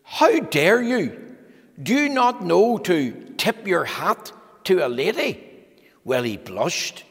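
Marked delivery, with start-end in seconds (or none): 0.0-5.4 Miss Splendid, affronted and huffy, higher at How dare you; outraged prim lady scolding a rude man; indignant lady. Acted. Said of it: "She said".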